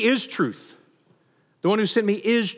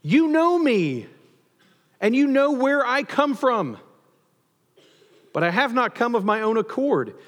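crying out; 5 semitones higher than usual. Acted. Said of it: "He cries out".

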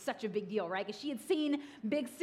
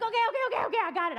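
flustered; 11 semitones higher, 7 dB louder, much faster than usual. Retold or imitated. Husband hurried reply.

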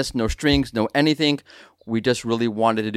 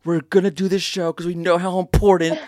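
mock caring host; 4 semitones higher than usual. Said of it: "Not like".

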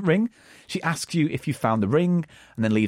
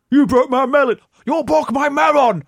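mock Japanese tourist; 9 semitones higher, 5 dB louder than usual. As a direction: wail it like a scammed tourist. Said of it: "drop a melon and go".

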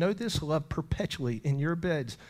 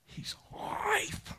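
high, tearful whisper breaking into a cry; 9 dB quieter than usual. Real, not acted. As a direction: let the voice crack with tears. Repeated, voice cracking into crying.

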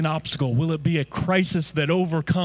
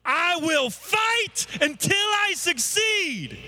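slow, high, fervent cry; higher, slow, full-throated declaration; like you mean it; 12 semitones higher, much slower than usual. Shown as a demonstration. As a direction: jump up and shout it with conviction. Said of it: "say it like you mean it".

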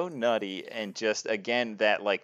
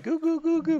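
much higher cooing voice; baby talk. Retold or imitated. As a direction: coo at a little kid. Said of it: "he's going, like".

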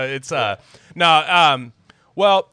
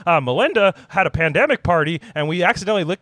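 high-pitched, faster mock tech-billionaire voice; Bill Gates; 7 semitones higher, 4 dB louder, faster than usual. Comic podcaster voicing a billionaire admitting a virus mishap, high-pitched impression. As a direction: put on a higher voice for a mock billionaire confession. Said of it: "Bill Gates is like".

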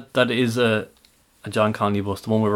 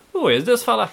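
high, put-on Jamaican accent; 12 semitones higher than usual. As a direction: mimic a Jamaican accent, pitched up. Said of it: "Did you like my Jamaican accent there?".

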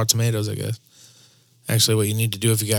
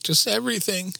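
high, soft upset voice; retold crying friend; 10 semitones higher, 4 dB quieter than usual. Retelling what his crying friend answered, high and upset.

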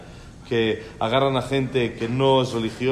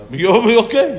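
mock shocked shout, louder and higher; surprised indignant yell; 8 semitones higher, 9 dB louder, slower than usual. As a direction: blurt it out in disbelief. Rabbi's indignant retort.

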